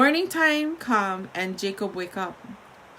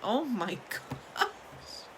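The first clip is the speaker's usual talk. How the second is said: laughing; 7 dB quieter, much slower than usual. Real, not acted. Reader laughing at the story.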